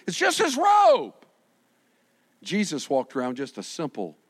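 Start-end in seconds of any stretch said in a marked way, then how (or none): 0.1-1.1 awed shout. Acted. Awed imagined words to Jesus.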